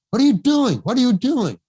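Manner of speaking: high, loud rebuke, falling at the end; indignant demand, pitched well up; John Huston's sharp challenge. Retold or imitated. Director challenging the casting director who steers the actor, sharp loud rebuke. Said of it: "Just like that".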